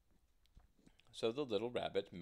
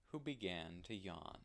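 plain reading voice

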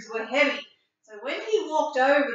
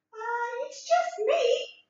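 high, small billy goat voice; a tiny nervous goat; little goat voice; 11 semitones higher, slower than usual. Acted. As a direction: squeak it out like the tiniest goat.

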